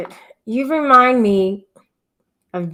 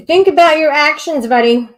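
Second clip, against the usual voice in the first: stern timeout voice, louder; mock scolding; 5 semitones higher, 7 dB louder than usual. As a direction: scold like a parent. Scolding chat user.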